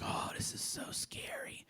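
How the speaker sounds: breathless hushed whisper; scared whisper. Retold or imitated. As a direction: whisper it like a spooked kid. Kids creeping through cemetery at night.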